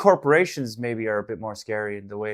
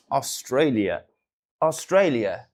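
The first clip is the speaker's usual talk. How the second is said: repeated Aussie Australia, drawn vowels; Australian accent; 3 dB louder, slower than usual. Pretend. Streamer practises Aussie accent, repeating the country name. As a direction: keep trying the Aussie Australia. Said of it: "That's it".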